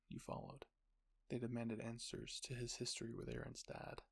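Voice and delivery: plain reading voice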